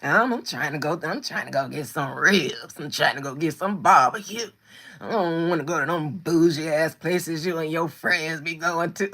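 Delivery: husband mocked, complaining about bougie places; mimicking husband. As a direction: grumble like a man who just wants ribs. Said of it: "He hates going out".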